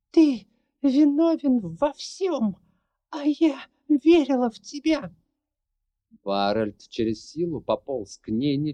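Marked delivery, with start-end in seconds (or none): none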